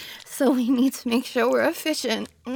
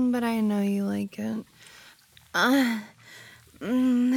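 ordinary talk

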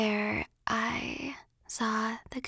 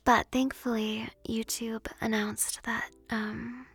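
whispering; 3 dB louder than usual. Real, not acted. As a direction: whisper close to the mic. ASMR chat whispered throughout.

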